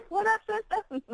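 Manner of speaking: high, teasing slang greeting; playful greeting. Real, not acted. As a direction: greet her like a bestie. Playful greeting to her friend.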